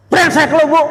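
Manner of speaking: mock insult. Acted neighbour insulting wife.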